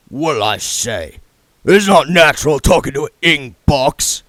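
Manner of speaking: damp growl. A narrator's reading. Mister Tulip grumbles at the box, damp growl. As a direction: growl like a heavy thug. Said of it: "the damp growl of Sister Jennifer".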